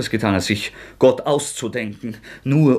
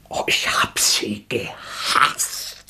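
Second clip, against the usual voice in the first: hushed confession; 3 semitones lower, much slower than usual. Acted.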